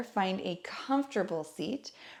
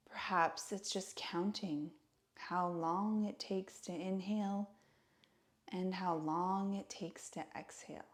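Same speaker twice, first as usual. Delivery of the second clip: guided meditation; 2 semitones lower, 7 dB quieter than usual. Acted. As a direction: guide listeners gently into stillness, hushed and unhurried. Leads a seated breathing meditation.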